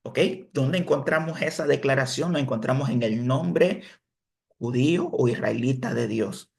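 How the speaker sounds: usual speaking voice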